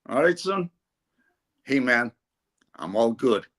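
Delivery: low, laid-back American voice; American accent. Retold or imitated. American player answering in the lift, mock American accent. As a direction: imitate a cool American replying casually. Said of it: "he had an American accent".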